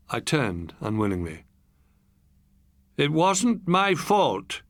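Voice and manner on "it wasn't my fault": very slow and steady; lonely man insisting calmly; steadily. Acted. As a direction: lay each word down evenly. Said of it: "he said steadily".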